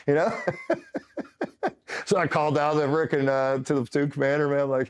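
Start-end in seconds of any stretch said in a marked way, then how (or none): 0.4-2.0 high-pitched real laughing fit; amused laugh. Real, not acted. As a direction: laugh helplessly at your own story. Laughs at his own story.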